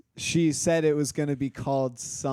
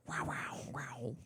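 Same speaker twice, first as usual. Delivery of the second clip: jaguar voice; 12 dB quieter, slower than usual. Shown as a demonstration. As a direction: growl it like a jaguar. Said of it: "that Jaguar growl".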